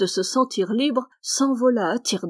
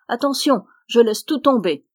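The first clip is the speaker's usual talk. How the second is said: giant's shout; 3 semitones higher, 5 dB louder than usual. Acted. Giant crushed by the tree, loud warning. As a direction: call out under the heavy load. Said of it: "lui cria".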